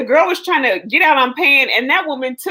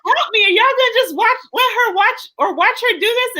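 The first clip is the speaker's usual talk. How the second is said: high frantic cries for help; begging the salon crowd to stop her; panicked plea; 10 semitones higher than usual. Retold or imitated. Retold lady shrieking at bystanders to help.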